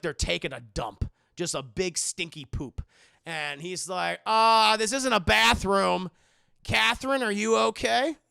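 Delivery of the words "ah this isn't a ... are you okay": loud startled call into the dark. Retold or imitated. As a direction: shout it up into the attic. Priest calling out in the attic.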